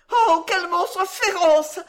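high, loud, drawn-out wail; horrified exclamation. A narrator's reading. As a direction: exclaim in horror at a monster. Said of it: "s'écria une autre sous-maîtresse".

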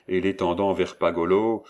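ordinary delivery, nothing marked

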